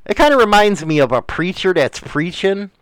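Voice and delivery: usual speaking voice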